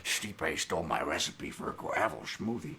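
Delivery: Nick Nolte impression. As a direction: croak it out like a burnt-out Hollywood actor. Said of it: "does [name] do the Nick Nolte".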